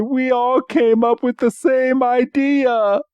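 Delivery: high mock-weepy voice; hammed-up, high-pitched touched-to-tears delivery; tearful Meowth. Meowth reunites with his teammates, high mock-tearful voice. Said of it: "he gets tears in his eyes".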